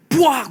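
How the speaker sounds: high, loud alien cry; loud eerie shriek; possessed shriek. Retold or imitated. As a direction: imitate the howl again. Noise sounds again.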